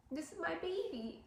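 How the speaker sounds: a tearful, breaking whisper of pride; real tearful break. Real, not acted. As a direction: voice cracking high and soft as tears come. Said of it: "I told myself I wasn't gonna cry".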